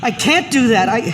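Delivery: high, loud desperate pleading; panicked pleading. Acted. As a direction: beg them to stop, panicked. Opening panic monologue.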